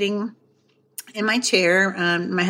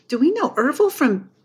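higher, anxious questioning; imitated dread, last words drawn out; mock terrified husband; 8 semitones higher, slower than usual. Worried husband asks about the deceased, mock alarmed. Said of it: "like, terrified look in his face".

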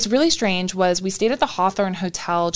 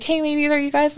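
mock sweet worker; 8 semitones higher than usual. Imitating the ghost tour worker.

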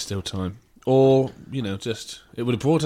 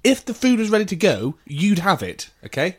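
exasperated retort; 6 semitones higher, 3 dB louder than usual. Retold or imitated. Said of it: "I was like".

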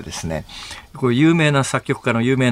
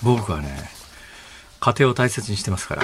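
低く声を落として気取った口調; 得意げな作り声; 6 semitones lower, 5 dB quieter than usual. Real, not acted. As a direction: put on a low, soft, self-satisfied voice. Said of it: "声まで変えて". (声まで変えたドヤ顔口調)

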